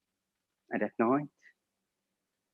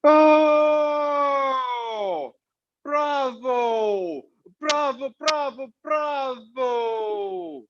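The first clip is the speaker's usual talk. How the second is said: high, shouted cheer, stretched out slow at the end; kids screaming; 8 semitones higher, much slower than usual. Retold or imitated. Kids cheering their win at dad, high screaming cheer. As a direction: scream bravo like a goal was scored. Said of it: "I hear the kids screaming".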